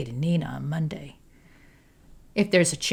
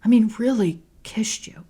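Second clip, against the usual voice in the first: higher, heated questioning; raised, hurt anger; angry; 7 semitones higher than usual. A narrator's reading. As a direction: pile the grievances on her. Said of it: "asks angrily".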